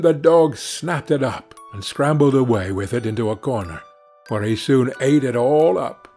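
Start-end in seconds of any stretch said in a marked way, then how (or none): none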